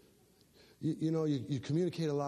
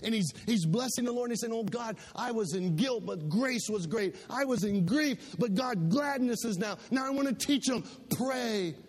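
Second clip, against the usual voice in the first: raised, ringing preacher's voice; preaching; 8 semitones higher, 3 dB louder than usual. Acted. Pastor preaching to his church, at full force. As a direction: lift the congregation with rising fervour.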